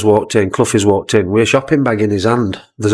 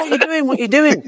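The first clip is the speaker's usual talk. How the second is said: mock Clough voice. Mimicking Clough's aggressive questioning.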